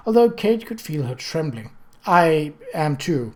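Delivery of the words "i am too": usual speaking voice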